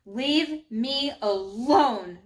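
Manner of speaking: slow, loud, growled warning; angry growl. A narrator's reading. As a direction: growl it at the bullies, drawing out each word. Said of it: "she growled at the girls".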